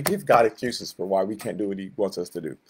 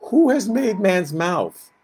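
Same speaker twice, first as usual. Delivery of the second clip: slow rebuke; 9 semitones higher, 3 dB louder, slower than usual. Acted. God rebukes Moses.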